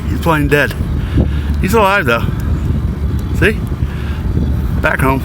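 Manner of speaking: high coaxing voice to a crab. Real, not acted. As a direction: sweet-talk a little critter. Coaxing crabs back to sea.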